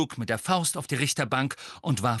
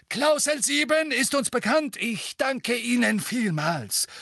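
growling; 4 dB louder than usual. A narrator's reading. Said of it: "knurrte Fudge".

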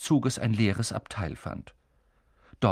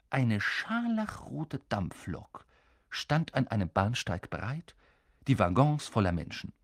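usual speaking voice

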